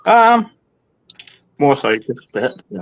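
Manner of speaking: talking around a paintbrush held in teeth; paintbrush in mouth. Real, not acted. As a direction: mouth full with a brush. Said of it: "I've got the paintbrush in my mouth".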